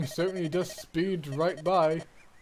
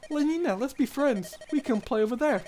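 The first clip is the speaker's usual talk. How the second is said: put-on higher, polite little boy; young boy voice; 9 semitones higher than usual. Roy greets his father's old friend, boyish and high. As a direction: play the shy, eager young son.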